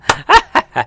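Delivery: short scornful burst of laughter; derisive laugh. Real, not acted. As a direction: laugh out loud in mockery. Laughs at absurd sentence.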